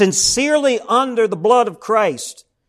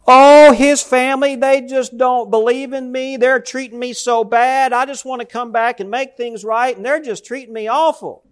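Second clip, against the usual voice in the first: higher, lightly voiced complaining young woman; imitating whiny ex-wife; 6 semitones higher than usual. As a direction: mimic her self-pitying complaint, raised pitch. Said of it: "she was just boo-hooing".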